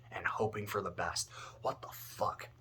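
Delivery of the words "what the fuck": breathy whispered what the fuck; whispered disbelief. Real, not acted. Appalled by child soldier training, whispered disbelief. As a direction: mutter it under your breath, appalled. Disgust at throwing kids to wolves.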